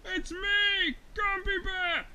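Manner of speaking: high, loud story-bear shout; calling out. Acted. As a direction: call down from up in the air. Bear calls down while flying.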